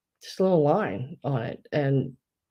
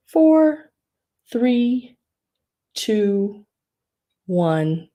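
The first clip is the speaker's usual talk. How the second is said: auction countdown; 4 semitones higher, much slower than usual. Real, not acted.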